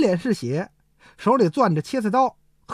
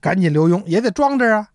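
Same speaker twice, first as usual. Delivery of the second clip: ordinary talk, nothing marked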